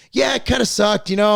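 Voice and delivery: high, loud imitation of his son shrugging it off; kid voice. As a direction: play the ten-year-old, chirpy and unbothered. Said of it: "He's like".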